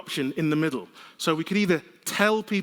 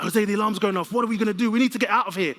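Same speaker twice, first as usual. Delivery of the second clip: panicked plea; 6 semitones higher, 4 dB louder, faster than usual. Retold or imitated. Panic retold to Jose at fire.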